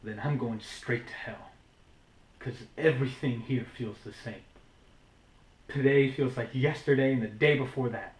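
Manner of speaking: weary despair. Acted. New monologue, weary despair at factory.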